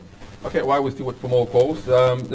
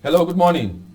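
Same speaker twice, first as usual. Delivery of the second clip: to someone hard of hearing; 7 semitones higher, 8 dB louder than usual. Real, not acted. Host calls new caller.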